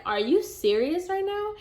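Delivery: can't believe he's silent; exasperated disbelief. Retold or imitated. To CJ who stays silent, incredulous exasperation.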